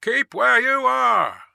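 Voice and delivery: loud, high, slow command; called order. A narrator's reading. Guard to voice in the mist, shouted order. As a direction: shout it across a distance. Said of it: "the guard called to the voice in the mist".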